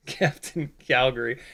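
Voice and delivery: softly laughing through the name. Real, not acted. Streamer amused by a chat name, laughing softly. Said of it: "I don't know why I'm laughing at that".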